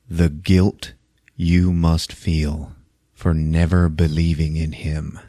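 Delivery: low, slow, soft menace drawn out word by word; menacing villain. Retold or imitated. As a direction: lean in close and let each word sting.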